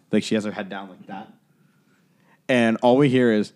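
normal talking voice

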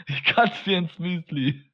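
slow menacing odd voice; menacing put-on voice. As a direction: drawl it out menacingly. (drohende verstellte Stimme)

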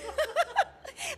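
a high, far burst of laughter; giggling at the memory of shaking. Real, not acted. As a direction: laugh at your own nerves. Nervous laugh about shaking page.

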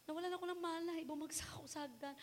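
retold tearful whisper; whispered crying. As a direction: tell dad through tears. Retelling fall to her dad.

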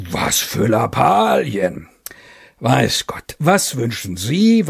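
put-on slow, brusque old general; dismissive general. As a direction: cut him off dismissively. General cuts him off.

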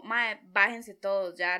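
slow, loud, furious order; super angry. Retold or imitated. Driver ordering everyone out of the van, slow loud anger. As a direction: spell out each word like a fed-up driver. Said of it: "Súper enojado".

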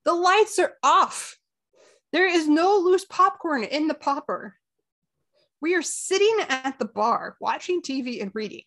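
loud, high, slow emphatic rant; exasperated. Retold or imitated. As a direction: spell out the obvious in exasperation, word by word. Venting at a clueless customer.

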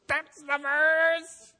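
high, loud, slow exclamation of finding; excited discovery. Retold or imitated. Preacher reliving finding the verse, loud slow joy. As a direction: cry out in delight at the discovery.

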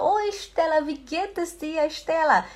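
high-pitched hello to a friend; acted friendly greeting. Acts greeting a friend met outside.

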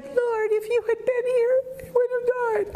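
mocking the wrong way Martha might have said it, high whine; whiny wrong reading. Shown as a demonstration. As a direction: whine it like a helpless victim. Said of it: "I don't think she said it like that".

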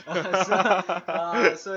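laughing. Real, not acted. Laughing after the joke.

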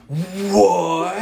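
put-on high-pitched, drawn-out kid's astonishment; awestruck kid. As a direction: mimic a little kid whose mind is blown. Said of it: "every eight, nine-year-old kid was just like".

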